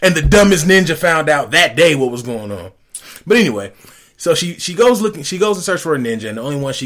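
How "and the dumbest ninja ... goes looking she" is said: loud, high-pitched exasperation; worked-up ranting. Real, not acted. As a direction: hammer home the absurdity, voice way up. Indignant rant about plot hole.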